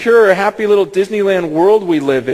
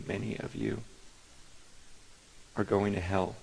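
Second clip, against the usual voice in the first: hushed confession; 13 semitones lower, 19 dB quieter than usual. Real, not acted. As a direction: drop almost to a hush, as if sharing a secret fear. Pastor confesses fear of hell.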